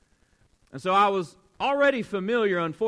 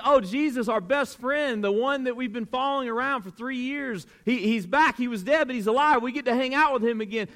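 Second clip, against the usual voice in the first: high-pitched mock excitement, tumbling along; excited disciples; 6 semitones higher than usual. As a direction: gush as if your best friend is back. Voices the disciples' joy at seeing Jesus.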